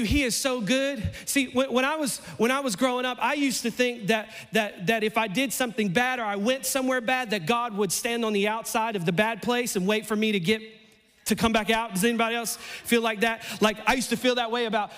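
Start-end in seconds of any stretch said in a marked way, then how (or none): none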